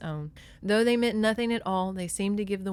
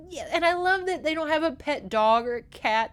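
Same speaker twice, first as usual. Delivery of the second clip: ordinary delivery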